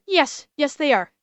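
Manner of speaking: usual speaking voice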